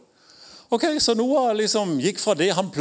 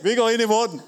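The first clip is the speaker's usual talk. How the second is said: higher, laughing Noah reply; cheerful Noah; 4 semitones higher than usual. Retold or imitated. Noah answering God, laughing and willing.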